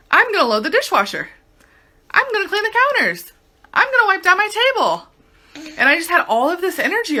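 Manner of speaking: high, eager to-do list; bright upward lift, raring to go; sudden burst of energy. Retold or imitated. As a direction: rattle off chores like you can't wait.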